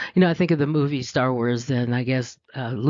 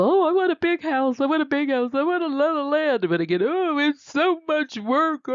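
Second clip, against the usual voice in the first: much higher, whiny mock voice; high whiny wannabe; 13 semitones higher than usual. Mocking people who crave big houses, high whiny voice. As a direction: whine like someone who wants everything.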